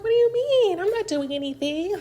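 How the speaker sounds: high-pitched mock innocence; playing dumb. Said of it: "Like, she's playing dumb".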